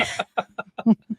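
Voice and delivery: quiet self-conscious chuckle; soft laugh. Real, not acted. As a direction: chuckle at yourself. Laughs at own joke.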